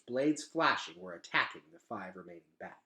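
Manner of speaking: ordinary delivery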